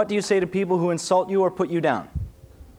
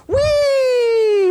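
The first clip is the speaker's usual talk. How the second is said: very high, loud playful squeal; a delighted high squeal like tossing a kid up; gleeful whee; 8 dB louder than usual. Retold or imitated. God lifting his child up, a loud high gleeful whee.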